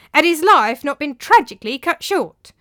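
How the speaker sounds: loud, high, testy insistence; irritable. Acted. Tranio defends his story to master, irritable. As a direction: press the point with irritation. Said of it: "said Tranio irritably".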